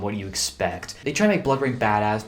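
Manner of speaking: usual speaking voice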